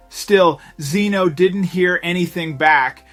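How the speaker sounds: plain reading voice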